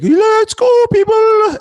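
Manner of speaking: mock Irish accent, squealed; high Irish voice. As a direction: shriek it like a tiny Irish hype girl. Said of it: "She has, like, a really high voice".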